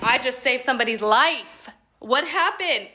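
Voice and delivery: loud, rising excitement, retold; excited outburst. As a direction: blurt it out like a proud rescuer. Acts out excited reply to dad.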